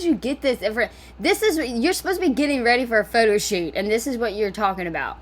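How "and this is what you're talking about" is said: normal talking voice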